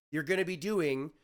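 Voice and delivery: normal talking voice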